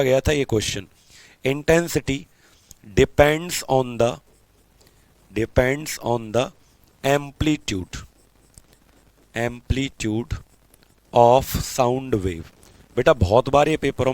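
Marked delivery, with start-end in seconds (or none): 1.4-4.1 very slow dictation pace; dictating slowly. Shown as a demonstration. 5.3-8.0 very slow, a bit softer repeat; slow repeated dictation; dictating slowly, repeat. Shown as a demonstration. 9.3-12.5 very slow dictation pace; dictating slowly. Shown as a demonstration.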